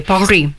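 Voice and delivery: PARIS in careful French, final S silent; French pronunciation. Shown as a demonstration. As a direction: say Paris as the French do, stress on the last syllable. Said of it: "ci tengo io a pronunciare bene il francese".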